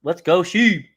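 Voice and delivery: loud, high hype shout; excited cheer. Real, not acted. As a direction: cheer the coin on. Cheering coin.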